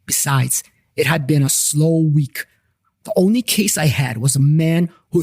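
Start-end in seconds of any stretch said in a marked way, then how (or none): none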